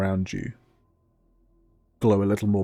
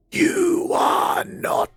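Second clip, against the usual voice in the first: slow, booming voice from nowhere; booming spectral voice; 12 semitones higher, 5 dB louder, much slower than usual. Acted. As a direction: boom it out like a disembodied spirit. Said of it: "hear out loud ... a voice".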